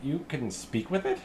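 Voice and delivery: can't believe what he just heard; astonished halfling. Acted. Halfling amazed the strangers talk to cows, high and astonished. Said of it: "He's like".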